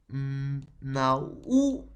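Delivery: "naúmedo" said slowly, syllable by syllable. Shown as a demonstration. Teacher showing the hiatus to the class: slow syllables. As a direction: draw out "naúmedo" one syllable at a time. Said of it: "Con O".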